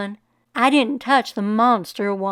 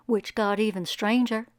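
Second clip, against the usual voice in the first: whisper; 7 dB quieter than usual. A narrator's reading. Storyteller whispers for suspense.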